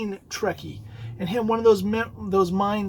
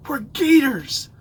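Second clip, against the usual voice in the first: louder and higher, drawn out slowly; proud reveal; 6 semitones higher, 8 dB louder, slower than usual. Retold or imitated. Friend proudly revealing the fan name, slow and loud. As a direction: announce it like a big secret.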